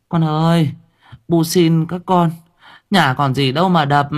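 high, pleading mother's voice begging her sons. Acted. Mother begging her sons to stop smashing things, high pleading.

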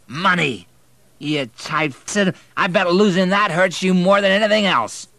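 high, loud scornful yell; angry shout. A narrator's reading. Quarreling man yelling insults over money, high and loud. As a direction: spit the insult at him.

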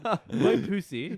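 Boy Pussy drawn out slowly with a rising end, mimicking the co-host's accent; imitating co-host's accent. Host teasing co-host's pronunciation, slow mock accent. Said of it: "as you say with your accent".

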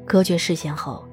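usual speaking voice

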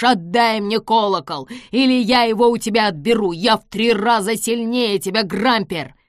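loud, forceful demand; threatening. A narrator's reading. Amos threatens to take the bell, forcefully. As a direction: press the threat home loudly.

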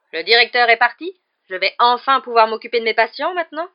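loud, high-pitched irritated matron; foul temper. A narrator's reading. Matron finally allowed to tend her patients, foul-tempered. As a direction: scold loudly in a very bad mood. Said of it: "Elle était d'une humeur massacrante".

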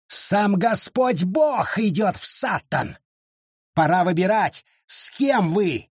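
slow, high and declaiming; fanatic proclamation. A narrator's reading. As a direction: thunder the parting warning. Wallace's parting proclamation.

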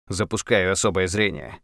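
ordinary delivery, nothing marked

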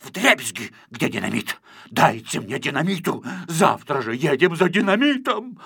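a furious little man raging; ranting. A narrator's reading. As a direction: rant on and on in high fury. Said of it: "Он ещё долго так разорялся".

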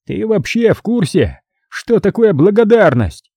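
high, indignant raging cardinal; a betrayed patron scolding, voice up high; cardinal's tirade. Acted. Cardinal berates the hero for his ingratitude, high indignant rant.

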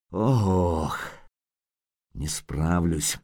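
slow, soft strained groan; straining. Acted. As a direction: heave and give up, groaning. Geralt straining to lift wounded. (натужный стон)

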